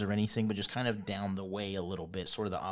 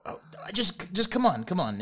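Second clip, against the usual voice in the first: put-on high, urgent old woman voice; a flustered granny waving them in; hurried old lady; 10 semitones higher, 8 dB louder than usual. Old lady hurrying the party inside, high and urgent.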